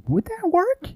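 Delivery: retold disbelief, pitch leaping high; incredulous question. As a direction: question it with a sharp rise at the end. Retells his own doubting thought.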